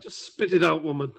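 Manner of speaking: impatient. Acted. Adventurer to the woman stammering a spell word, impatient. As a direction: cut in, fed up with the stammering.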